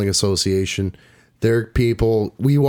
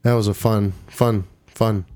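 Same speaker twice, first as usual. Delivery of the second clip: sarcastic; 2 semitones lower than usual. Real, not acted. Announcer recalling a hard event, sarcastic. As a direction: drag out the praise with pauses, voice sinking. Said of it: "a hint of sarcasm".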